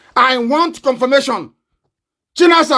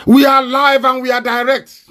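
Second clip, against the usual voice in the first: ordinary delivery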